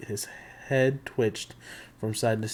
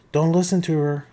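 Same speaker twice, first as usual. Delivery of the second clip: louder, higher warning shout; 5 semitones higher, 8 dB louder than usual. Acted. Annabeth shouting to Percy from the statues. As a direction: cry it out from hiding. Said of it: "Annabeth's voice shouted".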